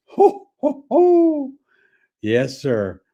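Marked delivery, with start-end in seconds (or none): 0.1-1.5 high, lightly voiced old comic's cackle; a sly imitated ho ho ho; Red Fox chuckle. Comic who worked blue, imitated with a high chuckle. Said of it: "was he dirty".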